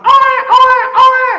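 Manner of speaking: very high shouted mock baby cry oe; laughing hammed-up infant wail oe; baby's wail. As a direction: squall oe, oe like a newborn. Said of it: "modalku cuman oe".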